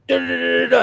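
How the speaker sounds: high rattling "da-da-da" burst; mock rapid-fire stutter of high-pitched syllables; imitated Gatling gun. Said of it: "Gatling gun Spanish when somebody just goes".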